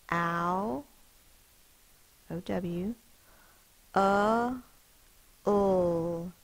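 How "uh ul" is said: "Uh, ul" said apart, louder; sounding it out. Shown as a demonstration. Teacher showing the schwa in towel, sound by sound. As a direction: stress the weak vowel sound. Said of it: "This says uh".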